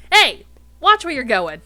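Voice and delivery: very loud, high-pitched angry yell; growled shout. Acted. Alien shouting after someone shoving through the crowd, angry yell. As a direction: holler after the culprit. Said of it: "growls another voice".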